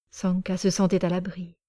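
plain reading voice

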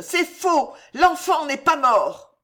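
high, loud, drawn-out cry; anguished high-pitched yell; screamed denial. A narrator's reading. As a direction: howl the denial desperately. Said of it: "hurla l'espionne".

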